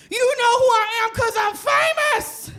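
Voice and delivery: put-on very high-pitched drunken screech; terrorizing shriek. Drunk customer terrorizing Shake Shack, high-pitched mock shriek. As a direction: shriek it like a self-important drunk. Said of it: "I sufficiently terrorize this Shake Shack".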